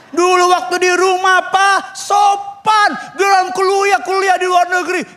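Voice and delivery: high, loud scandalized complaint; indignant rant. Acted. Parent complains about child who studied abroad, high and indignant. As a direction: shout it with mock outrage.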